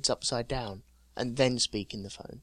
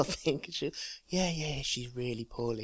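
mum on sick call; 8 dB quieter than usual. Retold or imitated. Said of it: "my mum acting".

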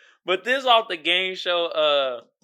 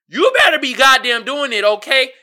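hype; 7 semitones higher, 10 dB louder than usual. Real, not acted. Hyping the audience.

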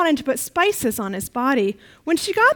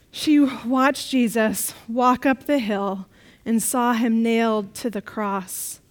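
ordinary talk

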